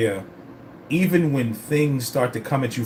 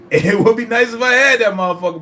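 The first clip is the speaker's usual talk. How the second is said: higher and louder, bursting with glee; excited outburst; 9 semitones higher, 7 dB louder than usual. Real, not acted. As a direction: repeat it, now thrilled and much louder. Laughing, repeats line louder.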